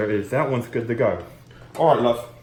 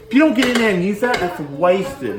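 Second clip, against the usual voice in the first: excited; 8 semitones higher, 7 dB louder than usual. Real, not acted. Excited cooking rant.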